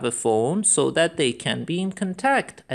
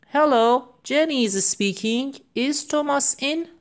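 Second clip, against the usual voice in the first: cheerful phone greeting; 9 semitones higher than usual. Pretend. Teacher voicing a phone caller.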